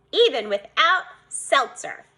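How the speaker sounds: usual speaking voice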